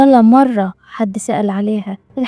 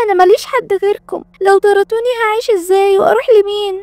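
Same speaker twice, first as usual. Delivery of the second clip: much higher, whiny fake-tearful voice; crocodile tears; 8 semitones higher than usual. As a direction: put on a pitiful act to stay. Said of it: "نزلت دموع تماسيح". (بكاء مصطنع بصوت عالي الطبقة)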